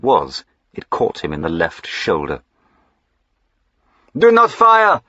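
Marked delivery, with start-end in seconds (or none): 4.2-5.0 high, loud sharp order; sharp call. A narrator's reading. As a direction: snap the order across the road. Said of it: "he called sharply to the coachman".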